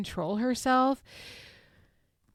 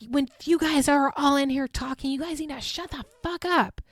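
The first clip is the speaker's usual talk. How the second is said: high, raised mock scream; retold scream; 5 semitones higher than usual. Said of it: "So Gigi screams".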